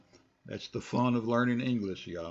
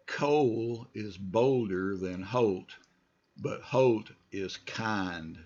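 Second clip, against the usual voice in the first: slow, clear read of the sentence; slow model read; slower than usual. Shown as a demonstration. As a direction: say it slowly for students to follow. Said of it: "just follow me".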